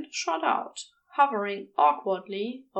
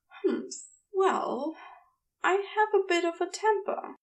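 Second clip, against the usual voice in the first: higher, gentle fussy gentleman; Ezra voice; 7 semitones higher than usual. A narrator's reading. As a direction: lift the voice for the prim angel.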